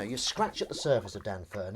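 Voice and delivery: ordinary talk, nothing marked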